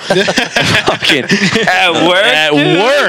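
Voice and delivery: high, slow laughing outburst; laughing disbelief. Real, not acted. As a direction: crack up in disbelief. Laughing retelling whiskey at work.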